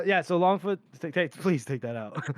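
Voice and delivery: usual speaking voice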